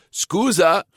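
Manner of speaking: mocking. A narrator's reading. Rival taunts the girl he passes, high and mocking. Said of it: "zei hij spottend".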